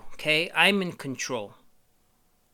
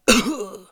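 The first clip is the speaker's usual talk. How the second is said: hammed-up cough; 9 semitones higher, 9 dB louder than usual. Homie melting.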